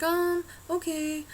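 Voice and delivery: high, drawn-out hesitant okay; imitated reluctant agreement. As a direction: give in slowly, unconvinced, as the salon lady. Salon lady gives in reluctantly.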